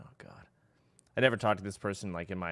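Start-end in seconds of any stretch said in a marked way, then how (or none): 0.1-0.5 breathy whispered dismay, barely audible; whispered oh God. Retold or imitated. Streamer reacting to a stranger's message, whispered dismay. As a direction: sink into a tired whisper. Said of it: "I'm like".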